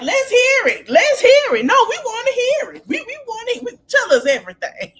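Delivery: high, loud, insistent squeal; hammed-up high-pitched excitement; imitated eager clamouring. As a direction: pipe up loudly, demanding to hear it. Acts out Contessa's eager demand.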